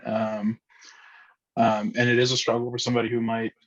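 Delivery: ordinary delivery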